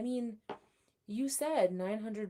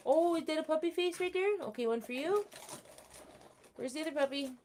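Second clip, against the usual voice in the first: puppy talk; 10 semitones higher, 3 dB louder, faster than usual. Real, not acted. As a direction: coo at the puppies like babies. Talking to her dogs.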